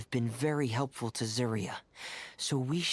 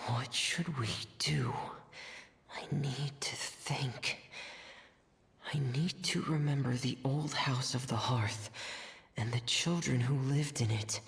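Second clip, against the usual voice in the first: whispered thinking. Acted. Thinking aloud to herself.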